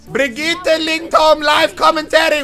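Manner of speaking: slow, high mock German announcer; mock German accent. Streamer introducing his commentary video, in a mock German accent. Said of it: "Why am I speaking like a German?".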